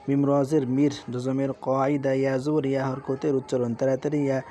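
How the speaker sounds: ordinary talk, nothing marked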